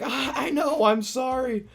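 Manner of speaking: high mock-apologetic character voice; imitating Arthur. Voicing Arthur's apology in retelling.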